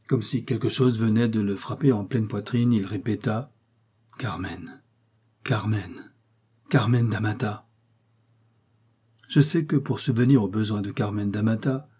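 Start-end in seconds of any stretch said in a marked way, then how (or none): 4.2-7.7 pained repetition. A narrator's reading.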